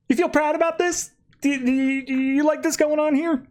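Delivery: very high-pitched mock indignation; indignant questioning. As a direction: challenge them in a squeaky-high imitation. Superman challenges Ancient Rao.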